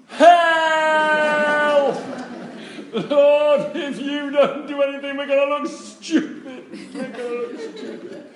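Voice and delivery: high, loud comic wail for help; mock inner panic. Minister secretly begging God for help, mock panicked wail. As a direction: wail a hammed-up desperate plea. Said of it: "Inside, I was going".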